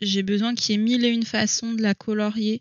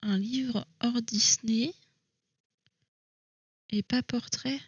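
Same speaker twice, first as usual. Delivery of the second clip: slow, softer whisper, mulling it over; whispered to herself; 6 dB quieter, slower than usual. Real, not acted. Rereading the viewers' question to herself, slowly whispered. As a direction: breathe the question out slowly, thinking.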